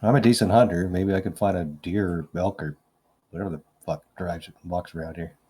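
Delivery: ordinary talk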